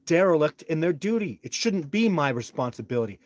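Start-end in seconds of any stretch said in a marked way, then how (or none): none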